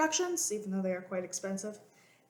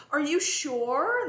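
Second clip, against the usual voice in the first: slow, higher teasing question; slow, higher playful doubt; 6 semitones higher, 3 dB louder, much slower than usual. Retold or imitated. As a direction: draw it out to tease a child. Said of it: "It's like".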